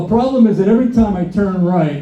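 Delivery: usual speaking voice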